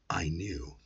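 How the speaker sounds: ordinary delivery, nothing marked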